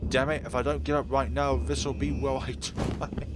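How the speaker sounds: cursing in mild panic; urgent alarm. Acted. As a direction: push it out under pressure. Fallen, must escape the creature.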